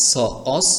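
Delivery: clean dental s in "অস", not sh; teacher spelling "অস" with an s sound. Shown as a demonstration. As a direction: say "স" then "অস" with a hissing s. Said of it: "তালব্য শ এর উচ্চারণ স্বরমতো ধরতে হবে".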